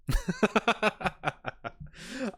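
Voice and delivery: high, drawn-out giggling laugh; real run of high laughter, tailing off. Real, not acted. Laughing at the guy who's donezo, high giggly laugh.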